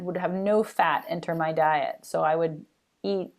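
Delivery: usual speaking voice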